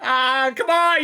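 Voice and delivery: usual speaking voice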